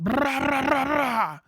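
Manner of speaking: high, loud "brah brah" gun sounds; mock gunfire noises. Veteran narrating a beach landing, mock gunfire sounds. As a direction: spit out playful gunshot noises.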